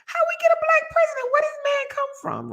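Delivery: high squealing disbelief; stunned high-pitched question; shocked disbelief. Retold or imitated. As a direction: squeal it like you can't believe it.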